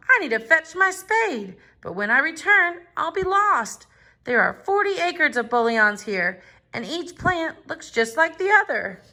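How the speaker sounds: a touch of panic in Tom's voice; worried Tom. A narrator's reading. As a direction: fret about losing the spot. Tom worried about getting lost.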